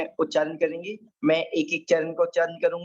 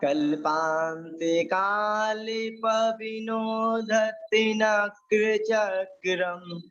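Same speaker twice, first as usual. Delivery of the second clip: slow, measured chanting of a Sanskrit shloka; teacher intoning a shloka line by line; verse recitation; 4 semitones higher, much slower than usual. Shown as a demonstration. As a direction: recite the verse slowly for the class to repeat.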